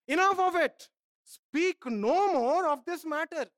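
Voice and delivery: God thundering a final no; stern rebuke. Acted. God's rebuke, voiced.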